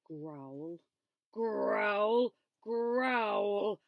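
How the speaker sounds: loud mock growl; hammed-up bear growl. A narrator's reading. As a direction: growl like three bears. Bears growling in story.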